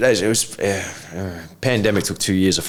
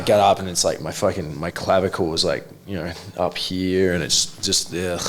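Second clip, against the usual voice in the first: ordinary talk, nothing marked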